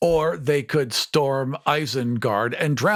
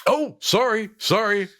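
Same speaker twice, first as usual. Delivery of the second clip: higher-pitched mock apology; a hint of a sheepish little beaver; lightly voiced apologetic beaver; 7 semitones higher than usual. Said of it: "the beaver's like".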